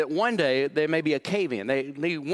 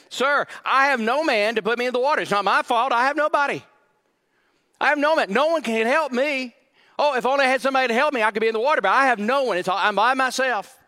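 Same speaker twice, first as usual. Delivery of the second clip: high, loud, self-pitying whine; whiny complaining man; 8 semitones higher, 5 dB louder, faster than usual. Acted. Pastor acting the man blaming others at the pool, loud whine. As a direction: play him as defensive and blaming. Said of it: "Look how he said it".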